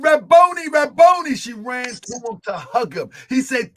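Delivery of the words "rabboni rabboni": loud, high cry of recognition. Acted. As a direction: call out his name in joyful shock. Mary crying out to Jesus.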